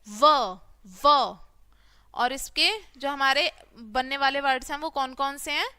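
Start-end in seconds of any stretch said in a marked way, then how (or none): 0.1-1.4 व said as a crisp short va, repeated; teacher sounding out व clearly; phonics sound. Shown as a demonstration. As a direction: sound out व for the class. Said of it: "कैसी आवाज निकलेगी वी में से".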